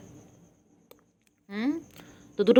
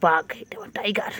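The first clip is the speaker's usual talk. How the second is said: breathy little secret; soft whisper; 2 semitones lower, 3 dB quieter than usual. Real, not acted. Whispering to the kitten, calling it her tiger.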